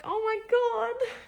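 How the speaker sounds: slow, high and loud gasp of joy; stunned with delight; overjoyed surprise. Retold or imitated. Husband appears at the door.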